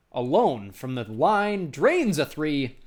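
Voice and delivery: drawn-out sportscaster call of a made three; excited basket call. Real, not acted. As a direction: draw out each word as the shot drops. Commentator calls a made three.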